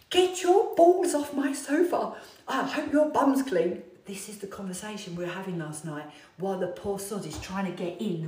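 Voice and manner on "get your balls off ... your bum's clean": high, loud, slow shriek; mock outrage. Wife yelling at husband on her velvet sofa, loud high shriek. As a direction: shriek at him to get off the sofa. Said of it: "I'm going".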